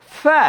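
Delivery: a clear f in ফা, not p; ফা said with f, never পা; correct fa. Shown as a demonstration. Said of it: "পা বলে ফেলি অনেক সময়".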